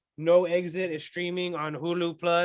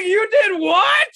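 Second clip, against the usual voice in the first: shocked, high and drawn out; a friend hearing her confess a dumb move, stunned and shrill; stunned disbelief; 13 semitones higher, 8 dB louder, much slower than usual. Retold or imitated. Shocked reaction to catfish call.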